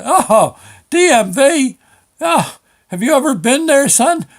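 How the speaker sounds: high, loud comic dread; mock horror. Customer reacts to banker sending him to the DMV, mock horror. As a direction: recoil aloud in exaggerated disbelief.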